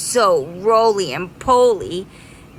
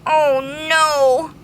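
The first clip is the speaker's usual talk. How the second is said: sad bee; 8 semitones higher, 5 dB louder than usual. Acted. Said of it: "said the greedy bee sadly".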